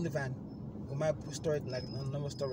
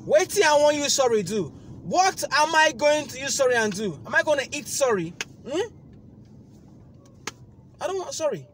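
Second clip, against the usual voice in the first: loud, high mocking rant; loud angry rant; 11 semitones higher, 12 dB louder than usual. Rejecting the agent's apology on the phone, angry rant. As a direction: pile on the scorn.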